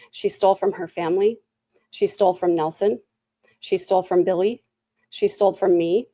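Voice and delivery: normal talking voice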